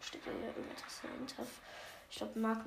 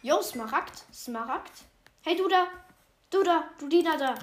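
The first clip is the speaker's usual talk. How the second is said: loud call across the room; shouting; 4 semitones higher, 14 dB louder than usual. Real, not acted. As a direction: call out to get its attention. Calling out to a servant mob.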